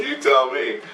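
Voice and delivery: very high mock-weepy voice; tearful imitation. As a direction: imitate someone squeezing words out through tears. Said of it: "He's crying".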